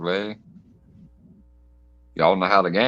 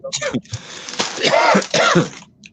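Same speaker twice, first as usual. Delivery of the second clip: rough choking cough fit; coughing on dust; 14 semitones higher than usual. Real, not acted. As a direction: hack out the inhaled dust. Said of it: "I inhaled some dust from the Dorito".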